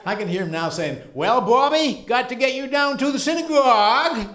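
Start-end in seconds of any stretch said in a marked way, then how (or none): 1.1-4.3 put-on high, loud old Maine grandfather's accent. Teasing his grandson Bobby about synagogue, loud high Maine twang. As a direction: belt it out like grandpa down east.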